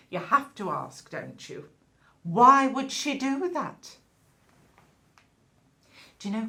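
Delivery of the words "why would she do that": louder, higher, drawn-out question; dramatic disbelief. Acted. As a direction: ask it slowly, scandalised. Gossip demanding a reason.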